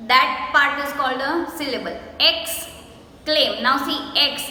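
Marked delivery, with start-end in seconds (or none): 2.2-3.6 "Exclaim" with a gap after ex; broken into syllables. Shown as a demonstration. Teacher repeating the break for pupils, "Exclaim" slowly split. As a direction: say "Exclaim" as two slow beats. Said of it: "where we break the word".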